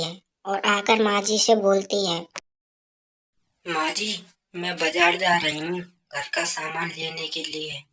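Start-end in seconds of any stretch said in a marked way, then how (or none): 3.7-7.8 put-on soft, timid young wife offering tea; softly offering tea to her mother-in-law; meek daughter-in-law. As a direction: hand over the tea quietly and politely.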